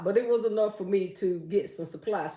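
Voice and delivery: ordinary talk, nothing marked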